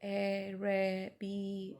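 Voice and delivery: each syllable of Araby stretched and separated; drawn-out syllables. Shown as a demonstration. Teacher shows how the word Araby sounds, drawn-out syllables. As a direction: spell out the name slowly, syllable by syllable. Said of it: "इस तरह करके निकल रहे हैं".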